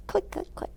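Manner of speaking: high, breathy whispered click, click, click. Retold or imitated. For the children, mimicking the librarian's computer: whispered clicks. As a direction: tap out the keyboard sound in a whisper.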